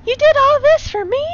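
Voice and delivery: high falsetto lady voice; high, earnest girlfriend voice; Sophie's high voice. Acted. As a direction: pitch way up as the bride.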